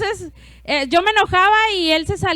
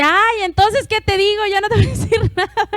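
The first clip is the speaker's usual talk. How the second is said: higher, louder, half-laughing give-up; exasperated husband; 6 semitones higher, 3 dB louder than usual. Retold or imitated. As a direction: throw up your hands, laughing, a bit louder. Husband gives up, retold. (el esposo, harto, se rinde entre risas, más agudo y más fuerte)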